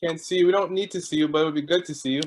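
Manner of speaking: ordinary talk, nothing marked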